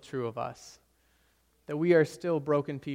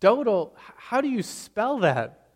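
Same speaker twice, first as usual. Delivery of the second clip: high, slow, puzzled asking; puzzled question; 7 semitones higher, 4 dB louder, slower than usual. Retold or imitated. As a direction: ask slowly, baffled by the name. Someone puzzled asks the spelling.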